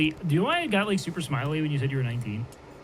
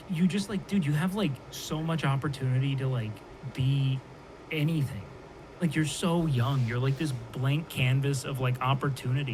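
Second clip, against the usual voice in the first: ordinary talk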